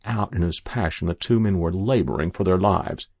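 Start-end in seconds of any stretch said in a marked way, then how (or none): none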